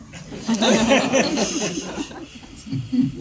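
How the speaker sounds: a long, high-pitched peal of laughter. Real, not acted. Laughing at the studio's regret over hiring him. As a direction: laugh it off, tickled by the story.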